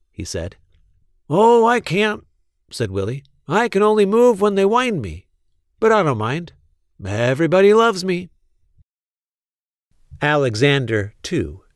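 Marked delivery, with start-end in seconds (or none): 1.3-8.3 lightly raised, cheerful toy mouse voice; a bright little wind-up mouse, proud of being loved; Willy's voice. Acted. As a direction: toy mouse bragging sweetly. Willy speaks again.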